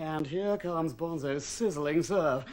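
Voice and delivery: low, hushed, slow commentary; soft, suspenseful commentator voice; mock sports commentary. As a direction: draw out the suspense before the serve. Commentates own imagined serve.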